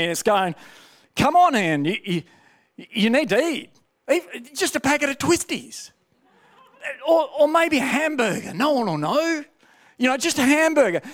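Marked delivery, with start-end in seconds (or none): 1.1-11.0 higher, coaxing wheedle about food; tempting flesh voice. Retold or imitated. His flesh tempting Ian to break the fast, higher coaxing voice. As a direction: coax like a hungry craving that won't quit. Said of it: "my flesh is starting to speak to me".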